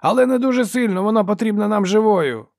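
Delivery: high, loud cautioning yell; man shouting. A narrator's reading. Brother wants Ferro taken alive, shouting. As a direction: yell a limit to the fighter. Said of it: "крикнув її брат".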